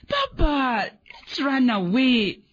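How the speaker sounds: put-on a frightened child's trembling plea; wobbly voice. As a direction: let the voice shake with fear. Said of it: "with wobbly voice".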